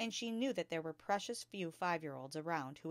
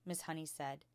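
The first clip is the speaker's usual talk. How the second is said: usual speaking voice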